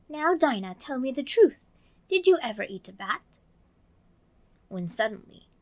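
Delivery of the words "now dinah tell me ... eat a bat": earnest Alice voice questioning the cat. Acted. Alice in her dream, earnestly asking Dinah. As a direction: ask it very earnestly. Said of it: "very earnestly".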